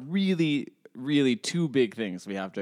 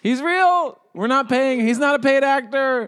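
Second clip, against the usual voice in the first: high, loud playful proclamation; mock shouting; 8 dB louder than usual. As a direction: shout it out high to convince the listeners. Mock announcing the guest is real.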